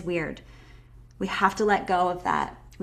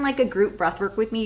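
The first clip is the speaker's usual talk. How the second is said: ordinary delivery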